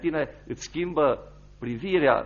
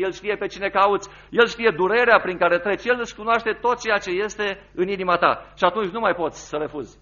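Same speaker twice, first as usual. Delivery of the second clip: louder, higher urgent sermon appeal; a fervent preacher's call to turn back; preaching at full force; 6 semitones higher, 6 dB louder than usual. Acted. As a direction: press the listener to answer now.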